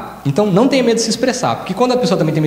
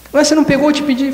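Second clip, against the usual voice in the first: higher, sharper complaint; indignant complaint; 8 semitones higher, faster than usual. Retold or imitated. Person in a rush complaining to family, higher indignant whine. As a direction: whine that you asked already.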